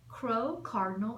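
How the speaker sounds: plain reading voice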